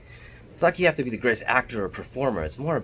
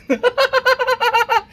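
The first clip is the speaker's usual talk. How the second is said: much higher and louder maniacal villain cackle; Joker laugh; 9 dB louder than usual. Shown as a demonstration. Vlogger shows off his villain laugh for viewers, loud cackle. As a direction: cackle like a comic-book madman. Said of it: "This is my Joker laugh".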